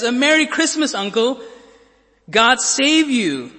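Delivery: high, loud jolly greeting; cheerful cry. Acted. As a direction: call out a merry greeting. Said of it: "cried a cheerful voice".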